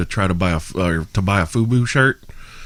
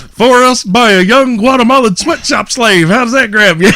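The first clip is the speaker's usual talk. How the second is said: loud stage comedian; 12 semitones higher, 6 dB louder than usual. Retold or imitated. Retelling the comedian's joke to friends, loud and high. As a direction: sell the punchline loud and high.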